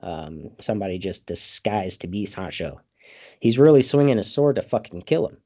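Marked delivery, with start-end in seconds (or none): none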